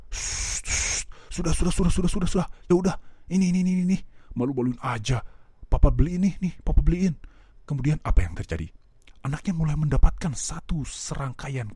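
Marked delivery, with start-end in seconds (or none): 0.1-1.1 unvoiced shushing; soft urgent shh; hushing. Embarrassed father hushing child. 1.3-7.2 embarrassed father. Retold or imitated.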